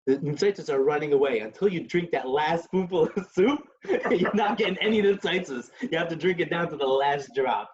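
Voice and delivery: laughing through the punchline; laughing Rebbe. Retold or imitated. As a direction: deliver the moral with a chuckle. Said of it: "He goes".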